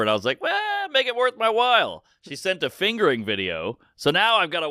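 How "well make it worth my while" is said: high, drawn-out mock hesitation; teasing reluctance. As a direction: stretch it high, playing hard to get. Coy reply to a come-on.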